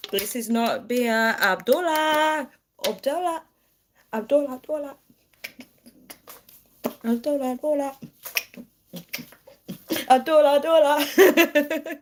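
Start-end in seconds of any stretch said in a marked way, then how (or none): 1.4-8.0 high-pitched teasing call; playful name-calling. Pretend. 10.1-12.0 high, slow drawn-out chant; high silly call on the edge of laughter; playful name-calling. Pretend. Chanting name, then bursts laughing.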